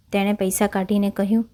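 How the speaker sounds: ordinary delivery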